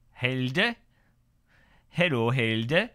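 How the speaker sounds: light mock Dutch accent on the name. Streamer greets a viewer in a mock Dutch accent. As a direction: play at a Dutch accent, drawn out. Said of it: "That was my Dutch impression".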